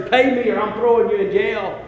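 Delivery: demanding creditor. Retold or imitated. Man to someone who owes him, lightly acted demand. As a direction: give a mild threat as the creditor. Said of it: "And he says to that person".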